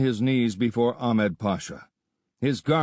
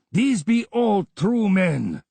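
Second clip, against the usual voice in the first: slow, higher and loud insistence; earnest vow; 9 semitones higher, 4 dB louder, slower than usual. A narrator's reading. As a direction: swear it slowly to the vizier. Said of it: "swore the Afridi".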